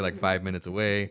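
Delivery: normal talking voice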